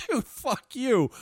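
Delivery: drawn-out laughing jab; playful laughing curse; laughing mock insult. Real, not acted. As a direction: toss it back slowly while laughing. Joking retort through laughter.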